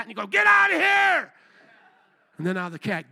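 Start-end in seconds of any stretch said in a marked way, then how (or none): 0.3-1.3 higher, louder, slow barked yell; yelling at a cat. Retold or imitated. Man at the bus stop yelling the stray cat off, loud shout. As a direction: stomp and chase the stray away with your voice. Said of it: "you yell at that little naughty little alley cat".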